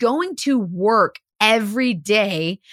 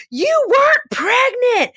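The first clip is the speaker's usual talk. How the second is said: high, slow yelled accusation; retold angry shout; 12 semitones higher, 3 dB louder, slower than usual. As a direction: yell it at her like the furious husband. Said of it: "the husband's going".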